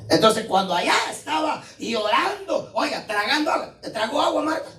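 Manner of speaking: rising preacher's shout; preaching. Acted. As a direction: drive the question home. Preaching at full force.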